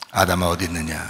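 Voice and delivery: low, softened divine call; lightly acted God, deep in the range; God calling Adam. God inviting Adam to confess, low solemn role. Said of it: "하나님이".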